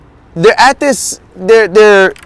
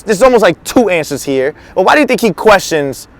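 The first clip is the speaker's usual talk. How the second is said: ordinary talk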